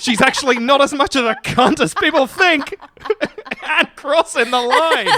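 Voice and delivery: high, loud, laughing delivery; laughing through it. Real, not acted. Host laughs while teasing her friend, high and loud. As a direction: laugh while finishing the race call. Laughing mock race call.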